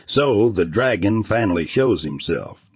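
plain reading voice

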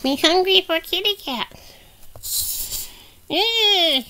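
high, slow whining puppet; Grogu. Pretend. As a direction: whine hungrily as a baby creature. Voices hungry Grogu.

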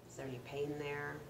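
calm meditation guide with long pauses; guided meditation. Acted. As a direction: gently lead listeners through the breath, unhurried, letting each phrase settle. Leads a breathing meditation.

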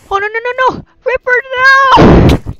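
long drawn-out loud cry of despair; anguished cry. Real, not acted. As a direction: wail it slowly as the pet dies. Pet dies in game.

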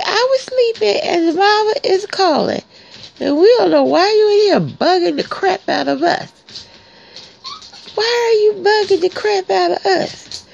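puppy voice. Pretend. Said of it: "I say".

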